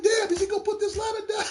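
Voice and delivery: high, loud disbelieving yell; mock shouted alarm; high shouted disbelief. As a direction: holler it high, half laughing. Quoting own reaction, laughing.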